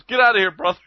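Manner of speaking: high, mock shooing call; lightly imitated guy chasing someone off; shooing passer-by. Old guy to a passer-by, high mock shooing.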